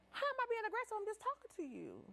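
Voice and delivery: indignant protest. Retold or imitated. Acts out the indignant reply.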